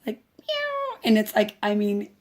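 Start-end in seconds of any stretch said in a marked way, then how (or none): 0.4-1.0 tiny squeaky kitten meow, much higher; a cute little meow pitched way up; baby meow. Retold or imitated. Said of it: "she makes the cutest little, like".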